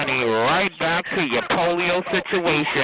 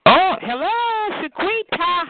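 mock greeting; 13 semitones higher than usual. Real, not acted. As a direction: sing out a showy, high greeting. Mock greeting to rival caller.

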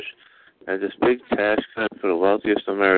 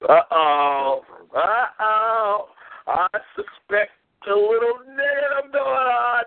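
mock alarm; 11 semitones higher, much slower than usual.

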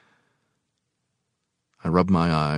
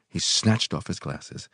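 usual speaking voice

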